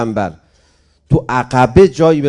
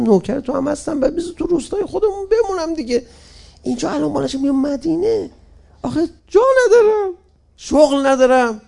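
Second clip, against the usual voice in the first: pleading villager; 11 semitones higher, 3 dB louder than usual. Acted.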